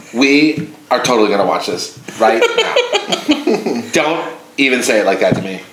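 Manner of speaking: lightly voiced ex, slowed and laughing through it; mock the ex insisting on her show, half laughing; ex-girlfriend impression. Man imitating ex-girlfriend picking the TV show, laughing lightly. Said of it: "it's always dead on".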